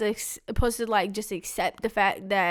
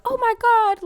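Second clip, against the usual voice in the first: high, loud gush of fake delight; mock cheery greeting; 14 semitones higher, 8 dB louder than usual. Daughter imitating the welcome her family expected, mock cheery. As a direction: gush it like an over-happy welcome. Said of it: "happy and cheery".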